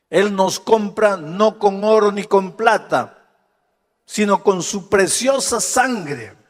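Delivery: stirring sermon voice; preaching at full force. Acted. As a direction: drive home the sacrifice from the pulpit. Preacher raises voice on redemption.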